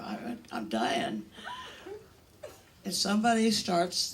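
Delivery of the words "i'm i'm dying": low, breathy whisper of a faked dying patient; mock dying whisper. Patient to nurse, mock dying whisper. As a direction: whisper it like your last words.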